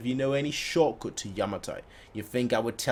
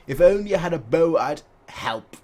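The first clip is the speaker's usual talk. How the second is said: usual speaking voice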